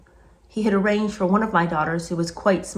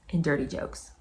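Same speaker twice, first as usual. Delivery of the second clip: usual speaking voice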